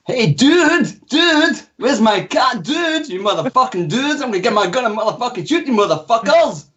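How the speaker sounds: high-pitched mock American surfer dude, Scottish underneath; failed American accent. Shown as a demonstration. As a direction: badly fake an American surfer accent, hammed-up. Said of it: "Scottish moron trying to sound like an".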